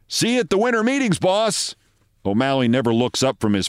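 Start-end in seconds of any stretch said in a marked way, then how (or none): 0.1-1.7 quick, higher-pitched call from the doorway; cheery parting call. A narrator's reading. General manager to his boss from the doorway, cheery parting call. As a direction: toss it off lightly, high and quick.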